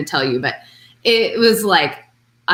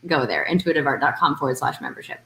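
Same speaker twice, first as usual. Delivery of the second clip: fast, rattled-off web address; quick plug; 4 semitones lower, 4 dB quieter, faster than usual. Shown as a demonstration. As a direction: toss off the address quickly as a reminder. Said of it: "So let's just plug right now".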